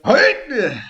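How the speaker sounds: high, loud martial arts cry; kung fu yell. Retold or imitated. Fan imitating the Doctor's kung fu, high mock yell. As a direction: let out a mock karate shout. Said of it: "his kung fu stuff".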